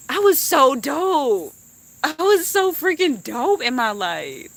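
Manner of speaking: high, slow, drawn-out brag; proud self-boast. Pretend. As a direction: savor every word like a proud legacy. Imagined proud last words.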